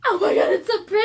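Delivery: excited squeal. Real, not acted. Unboxing the third book, squealing loudly and fast. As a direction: blurt it out in a rush of joy. Sees book three's end papers.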